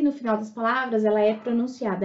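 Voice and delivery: usual speaking voice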